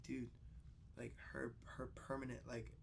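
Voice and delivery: very soft, near-whispered bad news; hushed mock gravity. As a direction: deliver the prank in a grave hush. Pranking friend with grave fake news.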